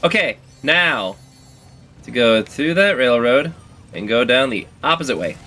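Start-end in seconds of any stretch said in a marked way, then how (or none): none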